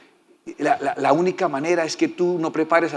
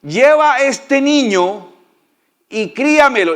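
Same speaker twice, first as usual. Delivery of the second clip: higher, louder, very slow royal order; royal command; 9 semitones higher, 5 dB louder, slower than usual. Acted. Pharaoh's daughter ordering the mother, slow loud and higher. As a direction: hand down the command like a princess. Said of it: "a la cual dijo la hija del faraón".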